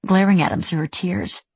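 plain reading voice

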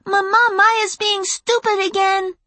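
put-on very high tattling little-girl yell; shrieking young tattletale; child's shriek. A narrator's reading. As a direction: tell on a sister at a shrill pitch. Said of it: "she shrieked".

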